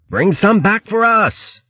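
high, drawn-out call. A narrator's reading. Sailor asking hare ashore for fruit, high slow call. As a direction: call it slowly across the water, pitched high. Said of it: "called Berg".

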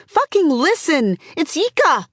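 high, urgent crowd shout. A narrator's reading. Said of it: "Someone else shouts her down".

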